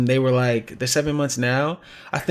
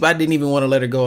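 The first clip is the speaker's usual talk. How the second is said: ordinary talk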